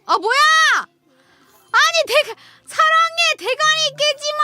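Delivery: very high, loud shriek; shrieking outburst. Real, not acted. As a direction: shriek at the screen. Outburst at draw result.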